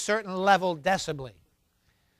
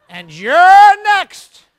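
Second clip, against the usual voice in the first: loud, slow and higher; slow warning as a spooky joke; mock ominous threat; 7 semitones higher, 15 dB louder, much slower than usual.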